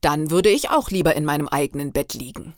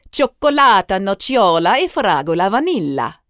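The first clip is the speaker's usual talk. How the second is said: high, loud, swooping Italian vendor's call; italienischer Eismann; 4 semitones higher, 8 dB louder than usual. A narrator's reading. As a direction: sing out the order like an exuberant Italian ice-cream man. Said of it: "wiederholte unsere Bestellungen auf Italienisch". (laut und hoch ausgerufene italienische Bestellung)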